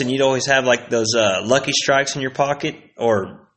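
ordinary talk, nothing marked

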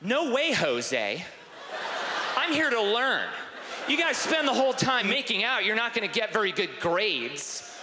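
high, preachy refusal; high, mock-indignant goody-goody; prim student. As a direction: lecture the girls like a swot. Imagined self primly refuses the girls.

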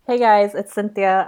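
ordinary delivery

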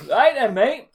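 usual speaking voice

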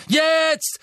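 high, loud battle cry; shout. Acted. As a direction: yell the signal to attack. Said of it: "rief Harry".

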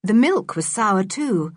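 ordinary delivery, nothing marked

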